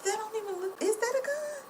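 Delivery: high, baffled disbelief; incredulous question. Retold or imitated. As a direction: squeak out is that a gun in disbelief. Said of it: "I'm like".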